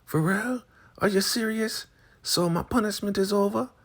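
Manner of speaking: very high squealing delight; imitating a punished woman hearing she is free, very high; excited falsetto. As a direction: squeal it in disbelief.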